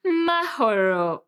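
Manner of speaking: slow, drawn-out, crooned Mahoro; lovey-dovey. Shown as a demonstration. How she wished her crush said Mahoro, lovey-dovey. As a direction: croon MAHORO with long, melting vowels. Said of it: "in a very lovey-dovey way".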